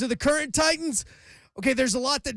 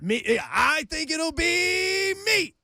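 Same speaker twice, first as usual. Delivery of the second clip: game show host voice; 7 semitones higher, slower than usual. Pretend. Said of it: "do that again".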